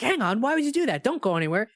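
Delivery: high, intense mock scolding; lightly voiced earnest reproach; stern Tom. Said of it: "he's like".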